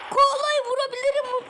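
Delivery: high, loud eager shout; thrilled at the target; excited. Real, not acted. Gamer spots koala target.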